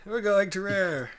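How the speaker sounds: high, squealing cheer; gleeful mock celebration; excited. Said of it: "we'll be so excited".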